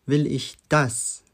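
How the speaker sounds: slow question landing hard on "das"; "das" set apart and emphasized; stress on das. Shown as a demonstration. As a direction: hold back, then punch "das" at the end. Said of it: "Betonung liegt auf das".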